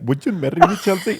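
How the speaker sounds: high, laughing imitation of an Indian accent; mock Indian accent. Shown as a demonstration. Man denies proposing that way, mimicking an Indian accent with laughter. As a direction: exaggerate the Indian accent, pitch rising on the name. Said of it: "I did not say it like".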